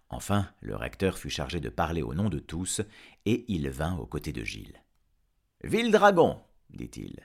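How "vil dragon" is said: high, loud address; stern denunciation. Acted. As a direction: proclaim it accusingly to the dragon. Rector denounces the dragon. (apostrophe sévère, forte et aiguë)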